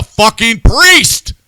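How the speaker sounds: loud, high, exasperated rant; fired up and yelling at the priest; irritated rant. Real, not acted. Angry host rants about a priest on air. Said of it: "I'm just irritated".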